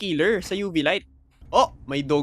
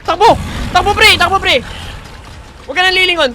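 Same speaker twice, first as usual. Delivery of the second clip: urgent panicked shouting; while running; 12 semitones higher, 13 dB louder, faster than usual. Real, not acted. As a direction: yell while fleeing. Running from monster.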